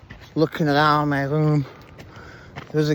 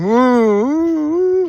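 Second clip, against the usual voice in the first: high, loud whooping calls; imitating a whooping patient; 11 semitones higher, 6 dB louder, much slower than usual. Imitating a hospital patient passing by, high whoops. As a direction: mimic an odd patient whooping down the hall. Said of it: "like this".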